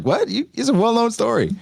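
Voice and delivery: high-pitched baffled retort; mock disbelief. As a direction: act astonished that nobody knows. Acting out baffled Jinbei's reply.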